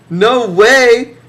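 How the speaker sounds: mock refusal. Refusing the kid who asks to start a band, loud high blurt.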